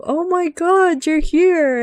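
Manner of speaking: high, slow, thrilled oh my god; excited surprise. Retold or imitated. Greeting Iguazu in the mission, high mock delight. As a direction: squeal it with delight at the reunion. Greeting rival pilot in game.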